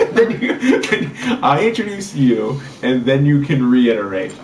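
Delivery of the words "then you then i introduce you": talking through laughter; laughing. Real, not acted. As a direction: laugh while explaining. Laughing while explaining.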